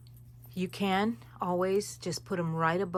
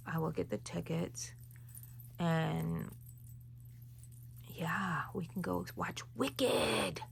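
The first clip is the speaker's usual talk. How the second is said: whisper; 6 dB quieter, slower than usual. Real, not acted. Excited secret plan.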